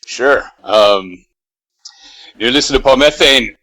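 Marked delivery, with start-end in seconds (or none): 2.4-3.6 loud radio-style track intro in American-accented Swedish; track announcement in Swedish. Shown as a demonstration. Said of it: "we'll have you announce the first track".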